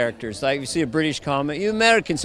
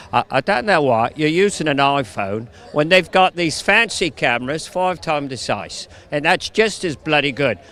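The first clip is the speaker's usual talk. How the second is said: mock Cockney film-star voice; Michael Caine. Actor complaining about iPhone filming, Michael Caine impression. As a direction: grumble it in a put-on London accent. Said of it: "I've lapsed into Michael Caine".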